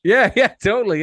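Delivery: high, loud laugh through the words; real amused laughter; laughing agreement. Real, not acted. Laughing at the mate's joke.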